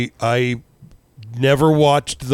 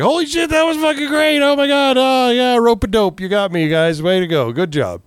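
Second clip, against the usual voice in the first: much higher, gushing with delight; thrilled; 11 semitones higher than usual. Retold or imitated. Recalling reacting to a great episode, ecstatic and much higher. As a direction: squeal your praise at the show. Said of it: "And I was like".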